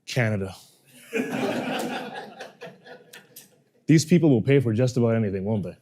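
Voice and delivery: low, fast, chuckling driver, lightly voiced; a hint of an amused old driver laughing to himself; laughing cab driver. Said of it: "this is exactly what he says".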